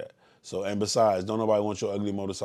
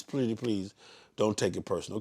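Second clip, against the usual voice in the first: usual speaking voice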